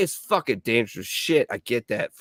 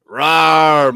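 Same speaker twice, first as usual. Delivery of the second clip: playful rawr; 4 semitones higher, 9 dB louder than usual. Pretend. Playful growl about husband.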